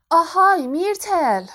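high, loud holler to someone away; shouted call. A narrator's reading. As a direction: take a breath and call out loudly. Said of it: "نفس عمیقی کشید و فریاد زد". (فریاد بلند و زیر برای صدا زدن)

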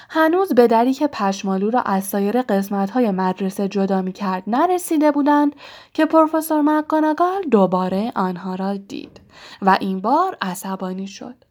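ordinary delivery

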